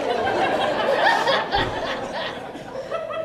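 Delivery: high rising giggle; high laugh. Real, not acted. Laughing at own joke.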